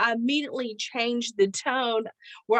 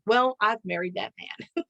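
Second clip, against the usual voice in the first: ordinary talk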